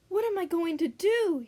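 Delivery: high, loud distressed wail; crying out. Acted. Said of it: "he cried".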